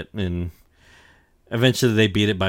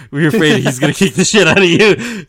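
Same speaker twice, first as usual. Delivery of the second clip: mocking laugh; 7 semitones higher, 12 dB louder than usual. Kuma taunting Moria, laughing.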